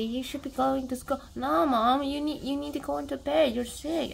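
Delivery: mock insistence from a worried kid; insisting child. Retelling a child urging sick mother.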